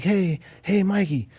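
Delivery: lightly voiced drummer calling out. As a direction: drummer calling the engineer over, lightly imitated. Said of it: "[name]'s like".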